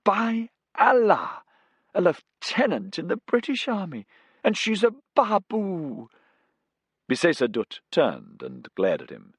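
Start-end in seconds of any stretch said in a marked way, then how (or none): 0.0-6.1 incredulous laughing outburst; laughing disbelief. A narrator's reading. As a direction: scoff at her rank in amazement. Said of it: "Ruddy laughed".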